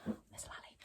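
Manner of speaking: furtive whispered question; paranoid whisper. Retold or imitated. Paranoid husband searching for the doll, whispering. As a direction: scan the room, whispering. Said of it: "cagey and paranoid and being like".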